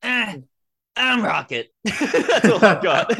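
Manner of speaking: high, loud cartoon raccoon voice; Rocket Raccoon. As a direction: bark it out like a brash raccoon. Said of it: "Do a Rocket Raccoon impression".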